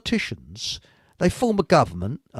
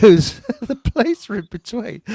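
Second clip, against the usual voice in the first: usual speaking voice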